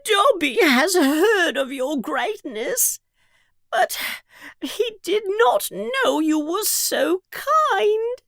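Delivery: high, wailing elf voice; tearful house-elf. Acted. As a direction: cry it out in a squeaky creature voice. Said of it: "begins to cry loudly and say".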